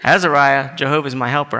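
ordinary talk, nothing marked

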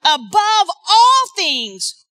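very slow, weighty; slow emphasis. Real, not acted. Preacher paraphrasing the verse for the congregation, slow. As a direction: lean on each word.

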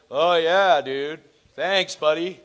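lightly voiced tipsy student; a hint of drunken dude brushing someone off; mock drunk college kid. Drunk college kid answering a preacher, lightly mocked.

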